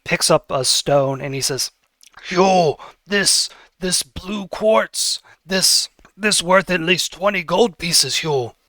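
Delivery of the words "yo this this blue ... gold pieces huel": higher, eager treasure-hunter voice; light up over the blue quartz; excited Billis voice. Acted. Billis shows Huel the quartz, higher and eager.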